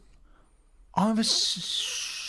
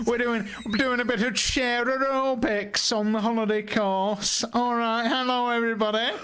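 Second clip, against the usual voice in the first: higher-pitched camp announcer greeting guests; holiday rep; 4 semitones higher than usual. Pretend. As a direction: welcome the campers over a mic. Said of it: "holiday repping in Skegness Butlins".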